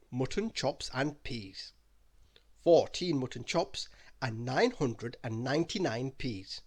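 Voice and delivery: ordinary delivery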